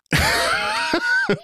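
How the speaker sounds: high burst of shouted laughter. Real, not acted. Admitting they had no plan, bursting into high laughter. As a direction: crack up hard, almost a shout.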